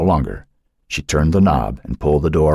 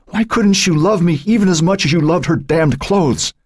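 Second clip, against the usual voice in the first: very high, sustained anguished wail; choking on sobs; 13 semitones higher than usual. A narrator's reading. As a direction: wail it through rising sobs. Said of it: "her voice choking on her sobs".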